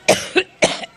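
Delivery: dry throat-clearing cough; quick cough mid-speech; coughing fit. Real, not acted. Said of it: "once I get over my allergic reaction".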